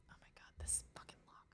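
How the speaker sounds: whispered cursing under breath; frustrated whisper. Real, not acted. Player whispering frustration at a game lock. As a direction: hiss it aside, fed up.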